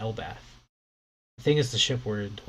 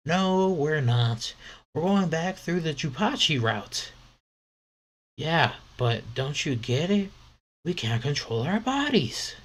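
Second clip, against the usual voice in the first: put-on arguing kid; 6 semitones higher, 4 dB louder than usual. Another kid answers.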